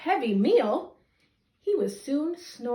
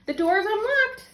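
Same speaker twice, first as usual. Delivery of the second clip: Granny's voice; 8 semitones higher, 4 dB louder than usual. Acted. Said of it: "said Granny".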